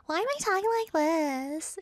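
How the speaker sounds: high, put-on questioning wail; mock whine. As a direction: whine it up high, playfully exaggerated. Acts out asking her mom.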